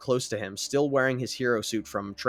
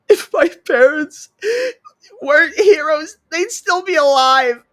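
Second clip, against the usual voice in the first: put-on high, loud tearful boy's cry; 12 dB louder than usual. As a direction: sob it out, voice high. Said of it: "Kota cries".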